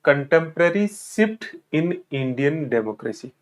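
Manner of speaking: very slow, each word laid out for notes; slow dictation. Shown as a demonstration. Teacher reads the syllabus title slowly to aspirants. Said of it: "ध्यान से सुनना इसे".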